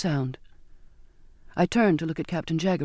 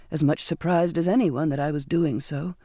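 usual speaking voice

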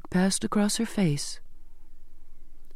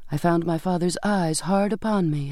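usual speaking voice

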